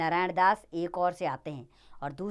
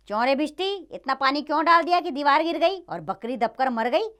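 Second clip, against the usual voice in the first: high, loud royal demand; acted king's raised, peremptory questioning; accusing interrogation; 6 semitones higher, 4 dB louder than usual. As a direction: call the accused to account. King interrogates water carrier.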